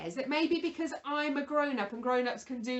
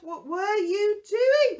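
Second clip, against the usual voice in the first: mock shock; 6 semitones higher, 9 dB louder, slower than usual. Real, not acted. Scolding puppet for messy paws.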